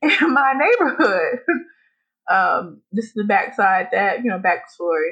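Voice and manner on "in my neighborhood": slow, higher words through laughter; laughing. Real, not acted. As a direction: squeeze it out while laughing. Laughing at own neighbor story.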